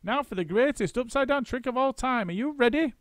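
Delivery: high excited ringmaster monkey; gleeful showman. A narrator's reading. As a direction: whip up the crowd for the big trick. Mugglewump's announcement.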